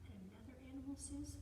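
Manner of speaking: usual speaking voice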